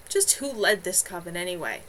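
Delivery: plain reading voice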